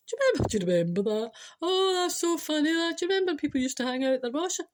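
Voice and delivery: high, loud-ish put-on amazement; exaggerated amused reminiscing; mock nostalgic gushing. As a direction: gush over old times, hammed up. Mimics amused nostalgic online commenters.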